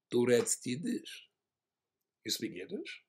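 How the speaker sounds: put-on Israeli accent. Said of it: "he said to me".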